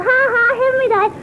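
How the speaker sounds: put-on high-pitched childish taunt; high sing-song mock tease; mocking teasing. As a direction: imitate a brother's jeer up high. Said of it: "kept laughing and teasing".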